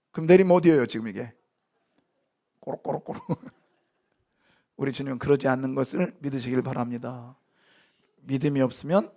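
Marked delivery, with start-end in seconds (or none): 2.6-3.2 unvoiced bubbling gurgle of sinking; drowning gurgle. Pastor jokes about drowning after being thrown off, gurgling. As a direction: gurgle like someone going under water.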